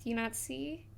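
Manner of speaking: very soft, halting appeal; hushed question. Acted. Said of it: "looks at you guys and he says".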